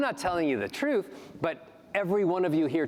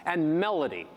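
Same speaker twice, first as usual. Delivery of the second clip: normal talking voice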